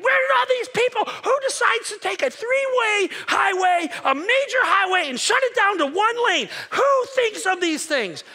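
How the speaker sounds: hammed-up fury at the traffic jam; mock road rage.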